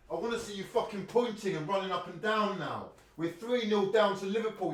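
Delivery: berating rant. Real, not acted. Berating a player from afar.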